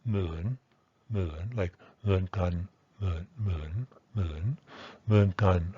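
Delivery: "moon" with its full falling-rising complex tone; careful unreduced Thai "moon"; Thai word moon. Shown as a demonstration. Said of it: "So here's the word".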